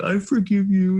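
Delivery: lightly imitated tearful child, pitched high; high, small voice of a forgiving daughter. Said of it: "And she was crying".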